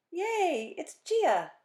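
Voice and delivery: high, loud happy squeal; a kid spotting her best friend, squealing with joy; excited cheer. Acted. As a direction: cheer like a delighted child. Kids cheering in story.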